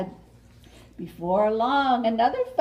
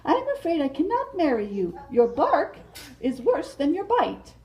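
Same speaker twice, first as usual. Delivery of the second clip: raised, sweet butterfly voice; Mariposa voice; 5 semitones higher than usual. Acted. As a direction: play the flirty butterfly asking her suitor. Mariposa voice, held role.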